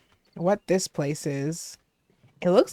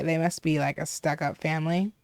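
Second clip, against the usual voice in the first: ordinary delivery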